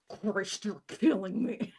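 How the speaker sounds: teeth clenched, strained; through clenched teeth. Acted. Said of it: "I exclaim through clenched teeth".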